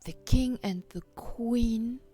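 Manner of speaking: plain reading voice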